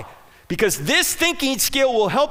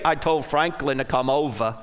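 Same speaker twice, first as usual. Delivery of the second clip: Billy Graham; 7 semitones lower than usual. Retold or imitated. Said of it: "Billy Graham had the North Carolina accent".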